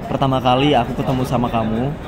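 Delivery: ordinary delivery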